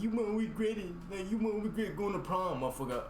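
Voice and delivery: high, mocking hype-man voice; mock pushy hype voice. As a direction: sell it like an overeager hype man. Mocking people pushing prom.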